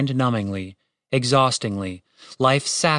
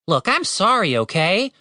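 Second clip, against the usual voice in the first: mildly testy, higher-pitched apology; 8 semitones higher than usual. A narrator's reading. Tim answers the raven who cursed him, testily. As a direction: apologise with a touch of irritation. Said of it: "said Tim testily".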